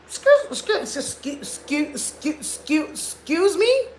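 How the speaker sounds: high, stammering excuse excuse in shocked outrage; indignant shrieking excuse me; outraged disbelief. Acted. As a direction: sputter it out, scandalised. Outraged reaction to an insult.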